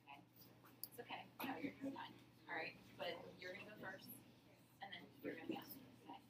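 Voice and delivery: hushed aside to the lawyers; whispering. Real, not acted. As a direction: whisper it off mic.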